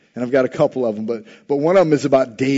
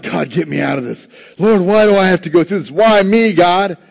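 desperate plea; 4 semitones higher, 7 dB louder than usual. Retold or imitated. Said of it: "You're like".

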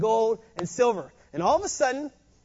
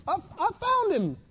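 confused treasure; 9 semitones higher than usual. Pretend. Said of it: "a really confused treasure".

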